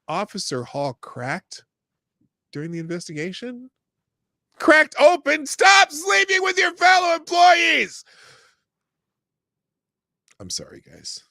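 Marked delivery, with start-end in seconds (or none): 4.6-8.0 loud, high, slow furious yell. Real, not acted. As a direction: bellow it at the whole department. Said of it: "Am I yelling?".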